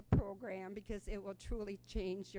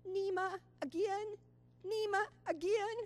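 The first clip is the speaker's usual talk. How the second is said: put-on much higher little-girl voice, begging; pleading toddler; 11 semitones higher, 4 dB louder than usual. Toddler begging great-grandma for another run, high pleading child voice. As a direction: ask again and again like an eager three-year-old.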